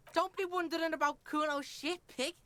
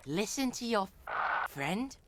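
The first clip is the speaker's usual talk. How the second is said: lower, menacing delivery; 7 semitones lower than usual. Pretend. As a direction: growl it like a villain. Repeats line in low voice.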